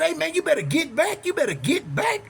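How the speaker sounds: high-pitched retold warning; imitated frantic warning without full volume; hollering. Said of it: "So I'm hollering".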